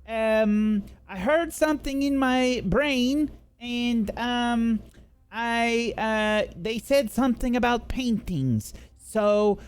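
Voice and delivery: high-pitched call out to the group; high, hesitant in-character yell. Acted. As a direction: call it out high, stumbling over the words. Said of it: "she yells".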